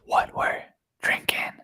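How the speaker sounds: hushed whispered echo; whispering. Acted. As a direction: breathe it in a whisper. Echoing in a whisper.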